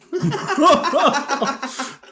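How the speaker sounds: loud, high burst of laughter. Real, not acted. Cracking up during the news impression, loud laugh.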